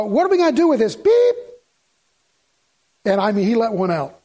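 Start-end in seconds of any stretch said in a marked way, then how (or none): 0.0-0.9 lightly voiced young boy, higher; a hint of a curious little kid; imitating a ten-year-old. Preacher retells the boy asking his dad, lightly voiced child. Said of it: "he said". 0.9-1.4 high, loud bleep sound; mock censor beep. Preacher covers the boy's cuss word, high loud bleep.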